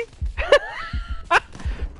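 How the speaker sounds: shrill squeal of laughter; high squealing laugh. Real, not acted. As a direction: burst into high breathless laughter. Laughing during game.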